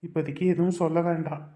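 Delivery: low, soft inner monologue; private musing. Acted. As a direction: think it to yourself, quietly. Man's inner thought over music. (மனசுக்குள்ள மெதுவா பேசுற குரல்)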